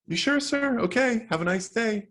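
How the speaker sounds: high, fast, eager helper voice; perky young shop girl. Acted. Girl employee offering to carry the ham, high and perky. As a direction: bounce it up like a chipper teenager.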